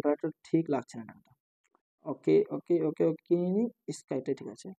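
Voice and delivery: low, soft talking to oneself; quiet aside to self. Real, not acted. Teacher checking the screen colour to himself, quiet low aside. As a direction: drop right down, half to yourself.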